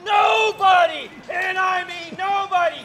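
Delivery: hammed-up outrage. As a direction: puff up and roar the threat. Hammy gunfighter threat.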